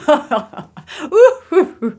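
big gloating wolf laugh, leaping high; acted villain's cackle with hoots; wolf's laugh. A narrator's reading. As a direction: laugh heartily as the wicked wolf, ending in loud high hoots. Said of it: "Il rit encore".

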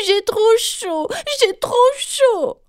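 high, slow wailing complaint; trumpeting Galipette. A narrator's reading. Galipette blares her complaint again, high and slow. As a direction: wail it out at the top of her voice. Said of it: "s'est remise à claironner à tue-tête".

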